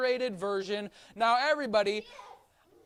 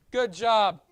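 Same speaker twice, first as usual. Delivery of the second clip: normal talking voice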